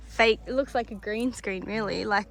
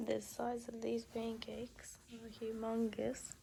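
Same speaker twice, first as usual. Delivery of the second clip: hushed early-morning whisper; 13 dB quieter than usual. Real, not acted. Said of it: "early morning, others asleep".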